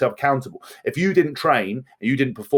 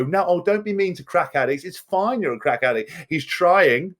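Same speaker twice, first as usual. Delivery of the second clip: mock do-gooder; 6 semitones higher than usual. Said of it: "people just go".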